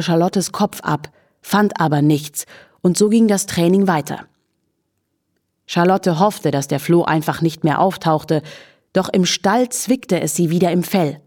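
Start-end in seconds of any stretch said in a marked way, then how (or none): none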